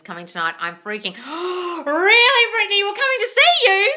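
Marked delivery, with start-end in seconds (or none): 1.2-1.8 excited gasp. Retold or imitated. Acting out friend's reaction. 1.9-3.9 much higher, loud, mock-thrilled squealing; loud high mock squeal of excitement; imitating excited friend. Acting out excited friend's call.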